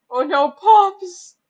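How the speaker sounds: loud, high, drawn-out wail; dramatic cry. Acted. Streamer announcing the cartoon lineup, dramatic drawn-out cry. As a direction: cry it out in mock alarm. Dramatically exclaims a show moment.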